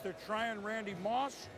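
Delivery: ordinary delivery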